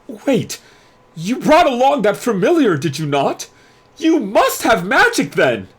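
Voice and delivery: high, loud and theatrical, announcing grandly; a pompous headmaster hyping the crowd; head mage hyping his special new student, high and loud; showy head mage. Acted. Crowley grasping at hope.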